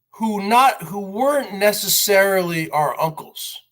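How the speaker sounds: ordinary talk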